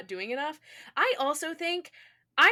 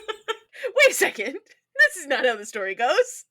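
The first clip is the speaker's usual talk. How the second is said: laughing realisation; 3 semitones higher, 7 dB louder, slower than usual. Acted.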